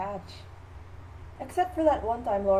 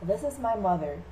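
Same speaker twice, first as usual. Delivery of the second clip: ordinary delivery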